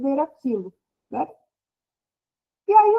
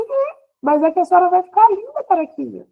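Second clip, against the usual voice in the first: reassuring little daughter; 6 semitones higher, 3 dB louder than usual. Retold or imitated. Imitating daughter reassuring her mother.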